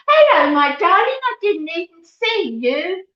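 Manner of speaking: high, loud, delighted hello; sudden joy on spotting her daughter; delighted greeting. Real, not acted. Mum greeting her daughter in the live chat, delighted.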